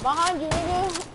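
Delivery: warning call. Real, not acted. Warning teammate of zombie behind.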